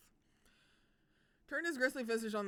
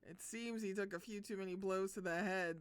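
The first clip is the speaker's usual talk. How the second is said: normal talking voice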